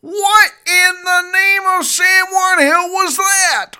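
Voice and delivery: high, loud, slow disbelieving yell; stunned outraged shout. Real, not acted. Reacting to the Obama clip, a stunned outraged shout. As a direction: shout it out in disbelief, dragging each word.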